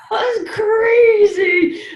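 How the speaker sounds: high, loud laugh through the words; laughing at how wild it was. Real, not acted. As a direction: laugh it out, slow. Laughing recalling sessions.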